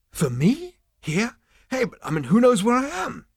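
high-pitched startled questions; incredulous surprise. Acted. Zaphod learns of a phone call, incredulous. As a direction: voice climbing in disbelief.

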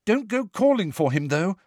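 high, urgent warning; pressing high-pitched caution. A narrator's reading. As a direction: warn them urgently, pitch jumping up. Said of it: "Hermione said urgently".

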